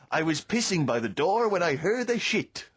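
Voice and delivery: slow, hammed-up French accent, 'Allo 'Allo style; comic French cop mangling English. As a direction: play the fake French policeman. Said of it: "I'm talking in a French accent".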